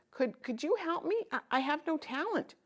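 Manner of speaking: put-on high, hesitant child voice, stammering; timid little girl. Child with no gift pleading with the scholar, timid and high. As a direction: ask shyly, stumbling. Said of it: "The child with no gift spoke again".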